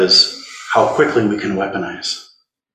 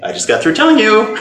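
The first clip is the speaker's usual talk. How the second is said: laughing; 11 semitones higher than usual. Real, not acted. Teasing laughing student.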